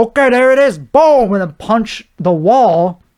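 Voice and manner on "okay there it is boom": quick, higher, louder snap into action; mock pumped-up instant reaction. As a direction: fire it off like instant reflexes. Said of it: "I didn't just go".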